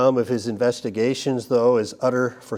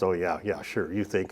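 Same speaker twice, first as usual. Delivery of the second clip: softer, lower, dismissive "sure, you think"; inward sarcasm; 4 semitones lower, 6 dB quieter than usual. Pretend. Preacher voicing the listener's silent doubt, soft and sarcastic. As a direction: roll your eyes inside as you say it.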